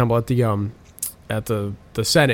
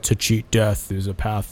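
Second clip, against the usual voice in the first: sinister voice; 4 semitones lower, 6 dB quieter, slower than usual. Retold or imitated. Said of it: "his voice changes".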